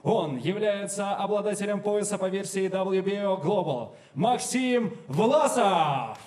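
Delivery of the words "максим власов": long stretched fighter introduction, louder; drawn-out name call. Real, not acted. Announcer calls out fighter's name. (протяжно объявить имя боксёра)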